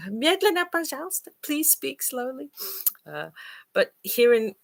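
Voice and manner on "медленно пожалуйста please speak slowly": pleading foreigner. Retold or imitated.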